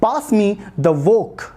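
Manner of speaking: very slow, each word spaced out; teacher modelling a request slowly; slow model phrase. Shown as a demonstration. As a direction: say it slowly for the learner to copy. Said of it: "तो बोल सकते हो".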